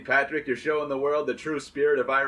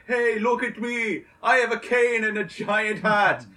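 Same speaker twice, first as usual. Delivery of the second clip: high, loud, slow hammed-up boasting; Saint Patrick's Day reveller impression; 8 semitones higher, 4 dB louder than usual. Mocking Saint Patrick's Day partygoers, loud hammed-up bragging. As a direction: show off cane and hat like a drunk reveller. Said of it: "this is my impression of Saint Patrick's Day".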